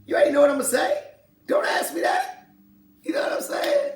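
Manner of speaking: high, fast, strained voice with a shouted unvoiced ending; mock President Clinton. A podcaster mimicking a reporter's question and the answer, high, fast and mocking. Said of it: "Like President Clinton".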